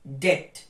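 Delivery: debt said det; debt ends on a plain t; silent b. Shown as a demonstration. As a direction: drop the b in debt. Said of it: "not debt".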